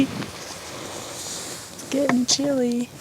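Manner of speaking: soft, slow murmur to herself; quiet chilly murmur. Real, not acted. Shivering at dusk in camp, soft and slow. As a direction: say it slowly and softly, half to yourself. Cold at sunset.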